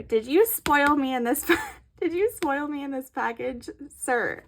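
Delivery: ordinary delivery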